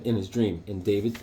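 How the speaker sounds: ordinary delivery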